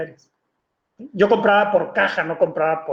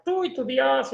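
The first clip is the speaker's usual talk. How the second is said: higher-pitched mock maternal jibe; scornful mother; 6 semitones higher, 4 dB quieter, slower than usual. Mother deriding her son's new religion, scornful. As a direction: imitate mom dismissing her son's faith. Angry mother mocks his faith.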